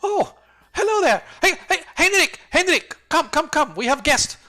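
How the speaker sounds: high, loud, chirpy shopkeeper calling her son; fussy shopkeeper woman. Acted. Shopkeeper greets newcomers, calling her son in a high voice. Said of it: "she's like".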